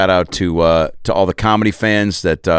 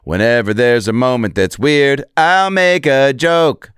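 rising high and stretched out; mock proclamation; 6 semitones higher, slower than usual. Comedian declaring what he does in weird moments, mock-grand. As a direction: draw out "I'll make a joke" high and slow.

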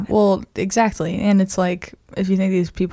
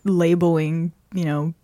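ordinary talk

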